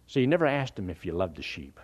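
usual speaking voice

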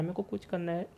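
a mild mock mumble of a lost student; trailing off. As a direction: let मेरे को कुछ करना है fade away as if giving up. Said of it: "वो कह रहा है".

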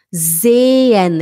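"Sehen" as zay-en, long loud e; silent h. Shown as a demonstration. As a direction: stretch the first e of "Sehen", no h. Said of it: "a gente fala sehen".

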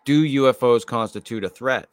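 usual speaking voice

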